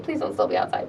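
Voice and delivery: whispered hope; hushed plea under the breath. Retold or imitated. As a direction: breathe it as a quiet, anxious plea. Hoping the crowd has left.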